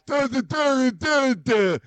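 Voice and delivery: high, wordless mock impression; high caricature, just duh sounds. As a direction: mimic an odd voice on nonsense syllables. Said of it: "should be a guy that goes".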